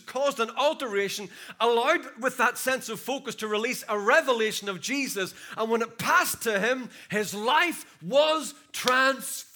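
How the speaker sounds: loud, rising pulpit preaching; full-force preaching. Acted. Preacher rousing the congregation, full-force preaching. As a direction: preach it out to the whole room.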